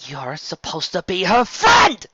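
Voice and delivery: high, loud, slow reproach; angry friend reproaching Trixie; angry exclamation. Acted. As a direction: throw the accusation at her. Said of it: "Bon Bon exclaimed".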